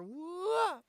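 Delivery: long rising "whoa" swooping high; playful upward whoop. Real, not acted. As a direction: let the whoa slide way up in pitch. Exclaims while zooming the view out.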